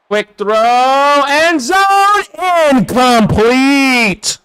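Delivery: high, rising call, last word drawn out; excited play-by-play. Real, not acted. Streamer calling a pass into the end zone, excited play-by-play. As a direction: build to the end zone, then stretch the result.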